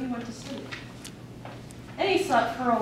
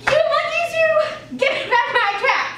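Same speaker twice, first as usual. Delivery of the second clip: much higher and louder furious shout; 6 dB louder than usual. Acted. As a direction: yell at the monkeys with everything. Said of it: "stamped both his feet and shouted".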